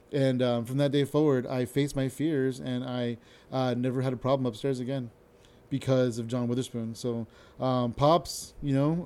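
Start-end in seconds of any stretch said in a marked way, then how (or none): none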